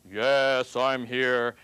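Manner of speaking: lightly voiced God answering from above. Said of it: "he hears this voice".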